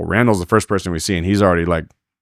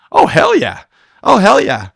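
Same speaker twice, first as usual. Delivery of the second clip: high, loud whoop of a yes; excited agreement; 12 semitones higher, 8 dB louder, slower than usual. Retold or imitated. Roper leaping at an offered partner, excited whoop. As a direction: jump on the offer, much higher and louder.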